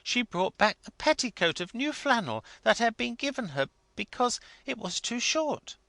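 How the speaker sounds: a hint of a high chattering wife; lightly voiced eager wife. A narrator's reading. Wife telling the clergyman her story, lightly high-voiced.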